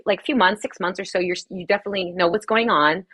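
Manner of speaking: normal talking voice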